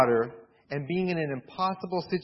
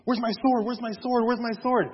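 panicked searching; 6 semitones higher than usual. Retold or imitated. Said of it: "the Moabites were like".

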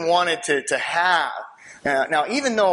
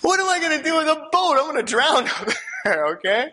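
laughing disbelief; 8 semitones higher than usual. Retold or imitated. Incredulous reaction, laughing.

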